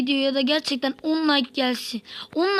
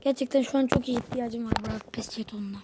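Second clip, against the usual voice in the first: hushed, breathy wish to himself; whisper; 3 semitones lower, 7 dB quieter than usual. Real, not acted. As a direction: whisper the hope under your breath. Alone at the screen, wishing for a token.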